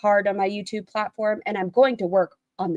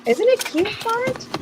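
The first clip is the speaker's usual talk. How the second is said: very high sing-song question; cutesy voice; 13 semitones higher, slower than usual. Acted. Streamer cooing a cutesy line to Bart, high. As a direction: coo it like a cartoon.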